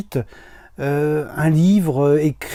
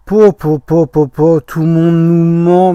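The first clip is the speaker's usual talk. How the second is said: exasperated outburst; 8 dB louder than usual. Real, not acted. Exasperated at conflicting news.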